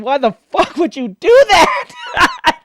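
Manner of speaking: loud, high, drawn-out outraged yell; mock pained scream. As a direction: imitate an anime hero screaming in outrage. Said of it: "Ichigo screamed in pain".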